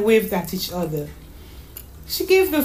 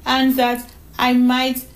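put-on high child's voice; child proudly listing her clues; cheeky little girl; 6 semitones higher than usual. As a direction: thank Mommy like a small girl. Voices young Donna May in story.

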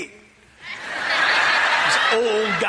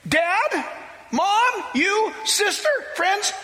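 fervent shout; 12 semitones higher, slower than usual. Retold or imitated. New convert shouting to his family to come to Christ, impassioned.

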